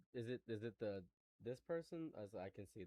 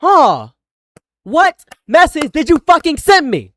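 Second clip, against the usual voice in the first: loud, raised, furious questioning; real anger; 6 semitones higher, 8 dB louder than usual. Real, not acted. Streamer demands where the message was sent, shouting angrily. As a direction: shout each question as an accusation. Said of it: "I'm so pissed right now".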